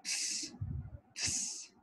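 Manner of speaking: थ्स breathed out soft, no voice; a breathy hiss on थ्स; pinyin c sound. Shown as a demonstration. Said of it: "सी को हम कहते हैं". (सी की ध्वनि थ्स फुसफुसाकर)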